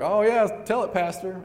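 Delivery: imitating an excited churchgoer calling out; congregation's cheer. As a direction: cheer the preacher on like someone in the pews. Voicing the congregation cheering on the preacher.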